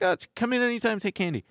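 high, sweet invitation; sweet coworker voice. Retold or imitated. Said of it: "She's like".

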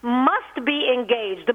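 ordinary delivery

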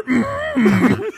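high hum like the Jedi; Yoda grunt. Pretend. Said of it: "can I take your order".

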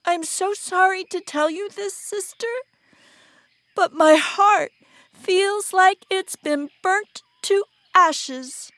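high, quavery-sad plea, drawn out at the end; hammed-up heartbreak; melodramatic grief. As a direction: mourn theatrically to your sister. Star heaved a great sigh.

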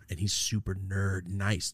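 normal talking voice

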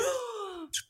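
quick pained gasp; sharp gasp from spicy heat. Real, not acted. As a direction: suck air in from the heat. Reaction to hot pepper.

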